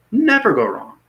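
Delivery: Never go wrong sung out high; high emphatic repeat. Shown as a demonstration. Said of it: "you'll never go wrong".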